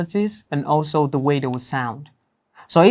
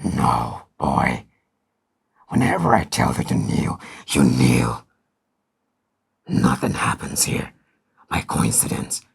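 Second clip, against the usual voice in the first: much lower, slow, menacing, ending in a whisper; villain voice; 14 semitones lower, 3 dB quieter than usual. Acted. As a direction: threaten like a dark villain. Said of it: "like a villain".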